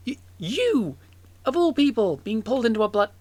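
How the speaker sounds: stammering, high-pitched disbelief; baffled, nervous. Acted. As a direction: trip over the start, thrown and incredulous. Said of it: "baffled about it".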